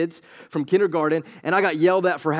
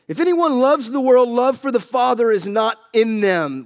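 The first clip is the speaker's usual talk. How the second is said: louder, emphatic scripture reading; preached verse; 5 semitones higher, 4 dB louder than usual. Acted. Preacher rereading the verse with force to the church. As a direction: drive the verse home.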